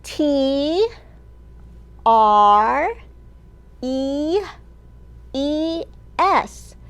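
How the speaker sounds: slow letter-by-letter spelling with pauses; spelling aloud. Shown as a demonstration. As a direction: spell it out for the kids. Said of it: "Will you spell the word trees with me".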